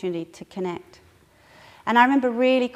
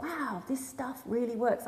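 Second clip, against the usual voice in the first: quiet wonder; 8 dB quieter than usual. Retold or imitated. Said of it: "I thought".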